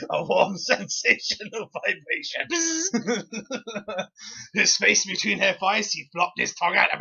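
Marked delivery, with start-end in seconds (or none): 2.5-4.5 soft, high, held-back chuckle; quiet snort of laughter; stifled laugh. Real, not acted. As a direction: try to swallow the laugh. Reader laughing.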